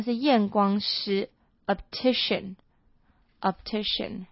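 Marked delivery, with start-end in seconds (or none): 1.7-4.3 "optician" said very slowly with the stress on "-ti-"; slow teacher demo of "optician"; slow model pronunciation. Shown as a demonstration. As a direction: spell it out with your voice, "optician", stress on TI. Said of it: "它的重音不太一样".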